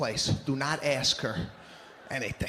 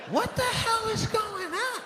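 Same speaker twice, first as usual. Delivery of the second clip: incredulous outburst. Retold or imitated. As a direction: stretch it out in total disbelief. Baffled outburst at the class.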